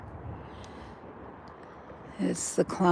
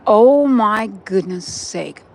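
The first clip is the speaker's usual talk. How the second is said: astonished; 3 semitones higher, 9 dB louder, slower than usual. Real, not acted. Surprised at what she sees.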